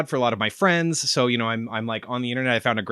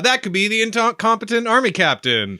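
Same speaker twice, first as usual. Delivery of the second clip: loud talker; 9 semitones higher, 4 dB louder than usual. Acted. Co-host joins the loud voice.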